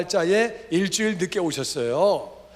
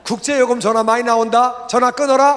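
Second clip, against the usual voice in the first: louder, higher brush-off; acted irritation; 4 semitones higher, 7 dB louder than usual. Older brother cutting off an international call, acted irritation. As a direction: imitate an annoyed brother cutting a call short.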